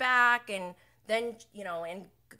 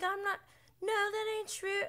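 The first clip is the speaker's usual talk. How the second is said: much higher defensive put-on voice; mock Lisa protesting; 11 semitones higher than usual. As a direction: squeak out the denial. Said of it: "She's like".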